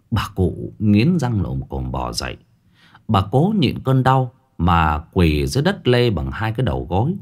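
plain reading voice

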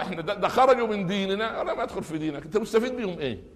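high-pitched mock protest; acted objection. Acted objection to a man's conversion.

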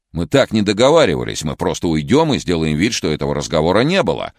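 loud, high, rushing at the end; panicked pleading. Acted. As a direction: plead fast in rising panic.

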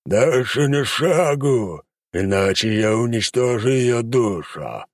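slow, high, strained demon roar. Acted. Demon warns the hunter to stop, roaring slowly. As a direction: threaten him with a drawn-out monstrous roar. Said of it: "проревел демон".

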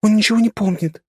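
high squeaky little voice. A narrator's reading. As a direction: squeak it out from the corner. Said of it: "пискнула из своего угла".

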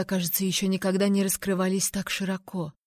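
usual speaking voice

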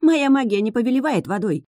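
high-pitched, indignant retort; exasperated objection. A narrator's reading. Witch told to drain the flood, exasperated objection. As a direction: explain it as if to a fool.

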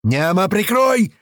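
high, drawn-out shout; yelled plea for cover. A narrator's reading. Клёпа climbing the car, yelling for cover. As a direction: holler to a gunner, stretching it out. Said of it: "заорал Клёпа".

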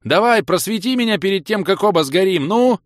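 high, loud desperate shouting; screaming back. A narrator's reading. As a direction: yell it at full tilt. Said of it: "завопил я в ответ".

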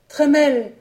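a dying man's last call of Trémel, rising in pitch; desperate cry of a name. A narrator's reading. A dying servant calling his young master's name, a desperate cry.

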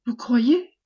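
alarmed question, swooping up at the end; terrified air. Acted. Wife answers the commander with a put-on frightened air. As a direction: ask as if aghast, voice lifting. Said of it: "d'un air épouvanté".

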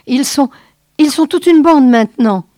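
doctor panics at the growing band; panicky stammer. Acted. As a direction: stumble over it in fright. Basset panics under arrows.

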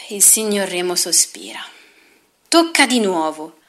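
plain reading voice

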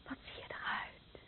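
breathless, toneless whisper; horrified whisper. Acted. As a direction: barely breathe the question in shock. Sees him by candlelight, horrified.

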